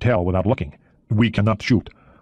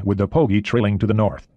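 ordinary delivery

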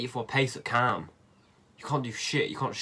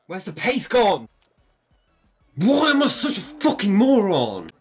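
loud, high furious outburst; raging gamer; 5 semitones higher, 8 dB louder than usual. Real, not acted. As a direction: rage at yourself, loudly. Raging at own play.

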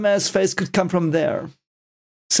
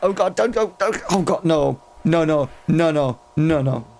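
scared horror game streamer; 3 semitones higher than usual. Real, not acted.

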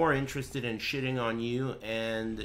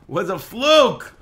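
loud, drawn-out, high exclamation; loud protest; 7 semitones higher, 12 dB louder, slower than usual. Real, not acted. Streamer protesting a mishap in game, loud exclamation. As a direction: shout it out, stretching and lifting the last word.

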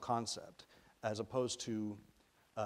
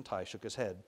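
ordinary delivery, nothing marked

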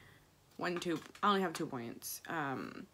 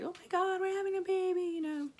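much higher squealing joy; thrilled about the baby, voice way up; excited; 13 semitones higher than usual. Retold or imitated. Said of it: "I was excited".

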